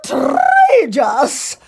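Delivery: high, slow shrieked outrage; screamed. A narrator's reading. Ugly sister shrieking as the slipper fits. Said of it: "screamed Griselda".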